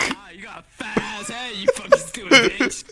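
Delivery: mock insult shout. Wife imitating what her husband secretly wants to yell. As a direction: blurt out the insult laughing. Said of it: "you wanna be like".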